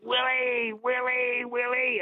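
high, drawn-out call across the hall; calling out to Willie. Retold or imitated. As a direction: call his name from the crowd, each Willie pitched high and falling. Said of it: "I was singing out".

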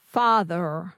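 American Father with a sounded final r; standard American. Shown as a demonstration. Learner echoing the coach, standard American accent. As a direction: copy a rhotic American Father. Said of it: "So that's the American".

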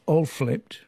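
usual speaking voice

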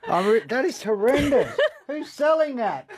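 high, laughing revulsion after a bad taste; real laughing disgust at a horrible mouthful. Real, not acted. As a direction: gag and laugh at the same time, voice jumping up. Tasting awful natto on air.

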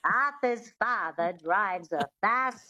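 slow twangy witch line then the model sentence; transatlantic twang. Shown as a demonstration. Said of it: "that twang is really important".

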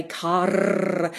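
"car" with a long trilled R at the end; rolled R. Shown as a demonstration. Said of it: "rolling R as being a primary feature".

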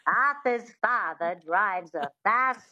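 slow transatlantic sentence with twang; drawn-out non-rhotic transatlantic. Shown as a demonstration. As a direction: carry the witchy twang into transatlantic. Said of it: "that twang is really important".

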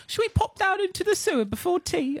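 very high, hammed-up British accent; a silly, garbled British accent; mock posh British accent asking about the sewer before tea; vague mock British accent. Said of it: "I don't know what accent that was".

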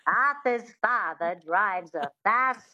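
witchy Mid-Atlantic twang, slow on the sentence. Shown as a demonstration. Coach runs both lines to show the Mid-Atlantic twang. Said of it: "that twang is really important".

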